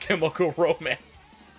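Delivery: ordinary talk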